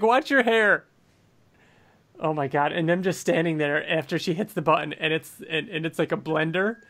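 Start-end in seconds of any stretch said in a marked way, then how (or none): 0.0-0.9 mock warning Mark. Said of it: "he's like".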